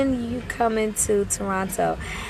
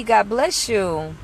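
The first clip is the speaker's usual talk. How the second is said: usual speaking voice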